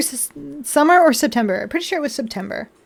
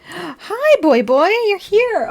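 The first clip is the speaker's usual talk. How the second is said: pet voice; 7 semitones higher, 6 dB louder, much slower than usual. Real, not acted. Greeting cat in pet voice.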